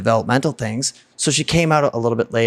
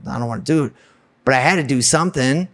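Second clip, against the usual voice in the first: ordinary talk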